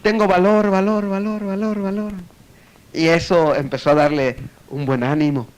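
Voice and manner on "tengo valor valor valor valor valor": high echo trailing away. Retold or imitated. Cave echoing the man's courage, fading echo. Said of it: "el eco empezó a gritar".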